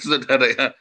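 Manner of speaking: normal talking voice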